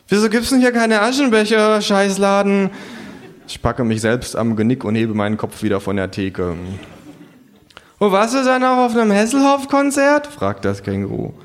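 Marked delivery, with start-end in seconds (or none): 0.1-2.7 high, loud grumbling curse; cursing kangaroo. Acted. Kangaroo cursing the bar for no ashtray, high and loud. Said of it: "und flucht". 8.0-10.3 high, loud cheeky kangaroo. Acted. Narrator voicing the kangaroo, high and cheeky.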